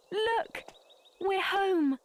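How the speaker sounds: high and bright, slowing on home; joyful homecoming. Acted. Princess telling her father they are home. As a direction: savour the word home.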